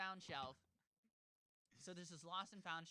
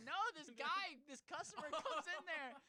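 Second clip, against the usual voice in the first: high, loud, amused retelling; animated imitated explanation; 7 semitones higher, 4 dB louder than usual. Barber woman explains who left binoculars, animated high-pitched imitation. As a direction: explain it pitched well up and louder.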